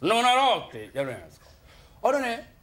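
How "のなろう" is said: louder, lightly done Inoki; a hint of a wrestler's growl; Inoki impression. Comedian showing how everyone imitates Inoki, lightly. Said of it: "猪木のモノマネします".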